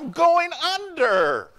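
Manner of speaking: high, loud, slow cry of going under; panicked cry. Retold or imitated. Preacher imagining himself sinking in the storm, panicked cry. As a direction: wail it like you're sinking. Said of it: "Then I'd be thinking about".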